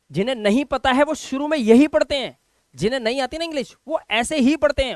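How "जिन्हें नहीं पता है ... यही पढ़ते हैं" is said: ordinary talk, nothing marked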